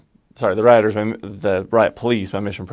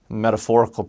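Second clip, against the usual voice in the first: usual speaking voice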